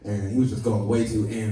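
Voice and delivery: normal talking voice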